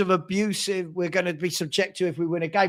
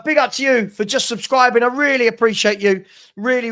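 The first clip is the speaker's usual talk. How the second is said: ordinary talk, nothing marked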